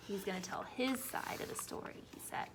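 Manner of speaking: voiceless storyteller whisper; whispered hint to the listening children. Acted. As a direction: lean in and whisper it. Whispered aside about the wolf.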